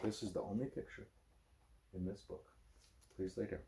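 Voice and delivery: hushed parent voice; soft bedtime hush. Real, not acted. Dad quietly settling his child at bedtime, hushed.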